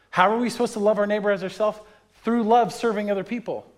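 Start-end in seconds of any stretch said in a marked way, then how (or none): none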